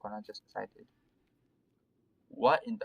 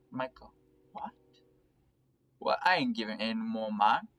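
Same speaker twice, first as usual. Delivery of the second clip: normal talking voice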